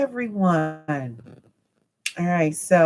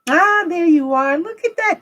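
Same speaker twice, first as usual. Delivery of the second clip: excited; 10 semitones higher, 5 dB louder than usual. Real, not acted. Happy to see the wrench.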